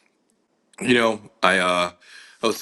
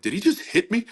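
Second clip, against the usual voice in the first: much higher, stunned question; shocked disbelief; 13 semitones higher than usual. Retold or imitated. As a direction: ask it in total disbelief, pitch way up. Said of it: "I was so shocked".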